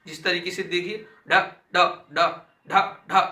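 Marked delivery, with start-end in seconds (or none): 1.7-3.2 ढ struck hard, louder, tongue flicking down from the palate; flapped dh demo. Shown as a demonstration. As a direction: snap each ढ out with a sharp tongue flap. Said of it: "जीभ क्या तालू से लग रही है".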